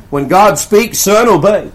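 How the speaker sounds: loud, firm order to a son; babanın sert ve yüksek sesli emri; father's firm command. Retold or imitated.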